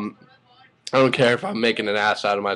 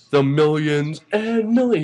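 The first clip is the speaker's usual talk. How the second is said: higher, punchy imitation of The Rock; Rock's millions catchphrase; 5 semitones higher than usual. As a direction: hype it up like The Rock on the mic. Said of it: "he did his".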